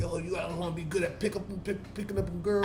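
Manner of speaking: a quiet, mock foreign accent with no real country; imitating a bad fake accent. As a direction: softly mimic a bad put-on accent. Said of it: "I don't like the accent he chose for that".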